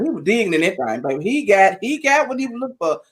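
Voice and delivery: ordinary delivery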